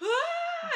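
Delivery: hammed-up, very high loud wail; imitated birthing yell. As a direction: act out a huge labor cry. Acting out her own birthing cry.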